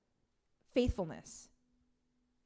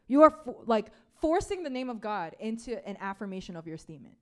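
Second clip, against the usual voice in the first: usual speaking voice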